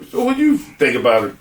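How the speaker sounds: usual speaking voice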